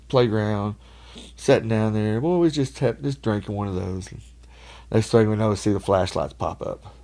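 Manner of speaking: ordinary talk, nothing marked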